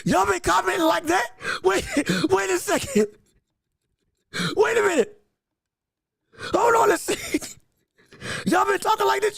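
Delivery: high-pitched, startled apology; flustered surprise. Real, not acted. Host finds he has missed his viewers' comments, flustered surprise. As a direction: blurt it high, caught off guard and mortified.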